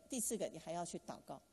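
normal talking voice

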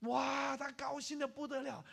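high, loud, elated storytelling; overjoyed exclamation. Acted. Preacher tells of the student's joy at recovery, elated. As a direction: burst out with joy, voice way up. Retells the patient's overjoyed reaction.

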